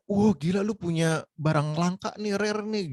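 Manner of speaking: high, drawn-out mock amazement; amazed gamer. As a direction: marvel like an envious fellow player. Acting amazed players seeing rare item.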